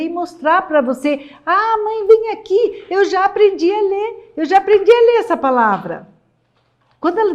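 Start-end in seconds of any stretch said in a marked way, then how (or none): 1.5-6.1 put-on excited child. Child calls mother, proud of reading.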